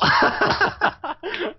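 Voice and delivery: high squealing burst of laughter; real high-pitched giggle. Real, not acted. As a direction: crack up high. High laugh after line.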